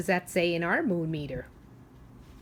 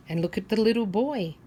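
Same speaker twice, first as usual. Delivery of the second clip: plain reading voice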